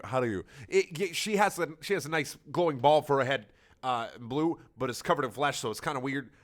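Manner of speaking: normal talking voice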